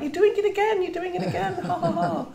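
high-pitched jeering imitation; singled-out-and-teased mimicry, ending in mock laughter; mocking schoolmates. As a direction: taunt like gleeful schoolkids, voice pitched right up. Voicing schoolmates mocking her returned accent.

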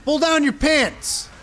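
slow, high and loud stretched yell; mock rallying bellow; drawn-out shout. Real, not acted. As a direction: drag the shout out for hype. Hype yelling at healer.

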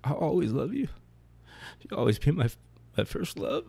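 mildly imitating mom's cry over him, high and breaking; retold wailing. Mother over her dead husband, a mild retold high wail. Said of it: "she wailed".

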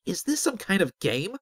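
high-pitched, indignant question; agitated, accusing challenge; demanding. A narrator's reading. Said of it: "Azric demanded".

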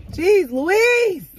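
high, loud cry of exasperation; exasperated outburst. Real, not acted. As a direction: burst out loudly, pitch shooting up.

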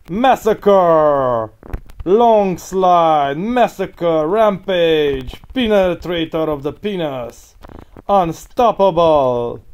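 loud, drawn-out game announcer; slow, loud hype-announcer shouts; announcer callouts. Pretend. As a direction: boom each callout like an arena announcer.

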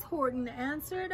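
plain reading voice

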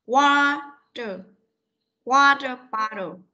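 "Water" stretched syllable by syllable, then "Water bottle"; slow teaching model. Shown as a demonstration. Teacher breaking the word down for a pupil, slow model. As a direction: draw out each part of "Water bottle" for a learner.

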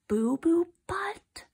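whispery, slow rising question; hushed, drawn-out double take; incredulous echo. Acted. Reader repeats name in disbelief.

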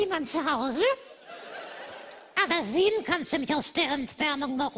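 usual speaking voice

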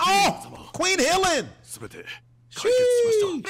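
high, loud burst of awe; excited exclamation. Real, not acted. Viewer greeting his favourite queen, excited high shout. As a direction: call out like a starstruck fan.

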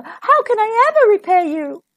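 high, gushing storybook mother; relieved, high-pitched exclamation; grateful cry. A narrator's reading. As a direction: pour out the gratitude. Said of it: "cried its mother".

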